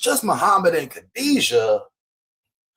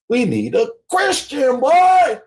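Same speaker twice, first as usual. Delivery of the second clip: excited shout; 6 semitones higher, slower than usual. Real, not acted. Excited realization.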